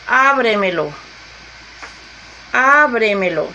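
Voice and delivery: "ábremelo" with a strong, held first syllable A; stress on first syllable. Shown as a demonstration. Teacher showing a class a sobreesdrújula word, stress on the A of "ábremelo". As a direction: punch the opening A of "ábremelo", then fall away. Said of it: "llevan el acento antes de la antepenúltima sílaba".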